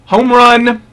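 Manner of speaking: high, loud happy cry; excited. Real, not acted. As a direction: burst out with delight. Excited verdict on tobacco.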